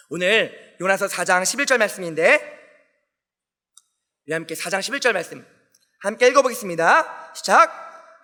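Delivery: normal talking voice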